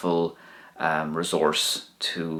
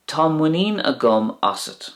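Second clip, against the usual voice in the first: "Tá muinín agam asat" pitched high, stress on "muinín"; high model; 8 semitones higher than usual. Shown as a demonstration. Teacher modelling the Irish sentence for learners, pitched high. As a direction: lift "muinín" high and fall. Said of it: "I have confidence in you".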